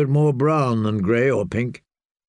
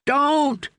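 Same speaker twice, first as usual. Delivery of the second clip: mild narrated shriek; 13 semitones higher than usual. A narrator's reading. Dick shrieking as the flail is raised. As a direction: cry out to shield himself. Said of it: "shrieked Dick".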